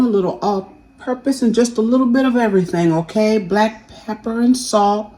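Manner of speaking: ordinary delivery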